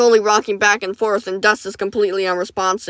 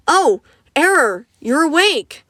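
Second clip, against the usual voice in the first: warm greeting; 7 semitones higher, slower than usual. Acted. Medic greets woken patient.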